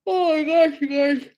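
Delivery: talking through a yawn; words stretched by a yawn. Real, not acted. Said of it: "how many more times I'm gonna yawn".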